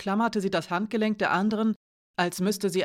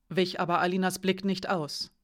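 plain reading voice

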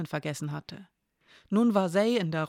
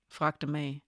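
plain reading voice

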